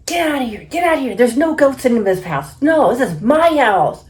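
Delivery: retold tirade, high and loud; angry dad. Dad ordering the goat out of his house, acted angry. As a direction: imitate an irate dad yelling, high-pitched. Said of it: "he is so angry".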